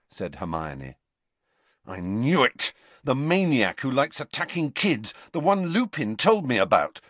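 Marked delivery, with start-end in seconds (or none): 1.9-7.0 a touch of anger, rising pitch; understated angry outburst. A narrator's reading. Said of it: "said Harry angrily".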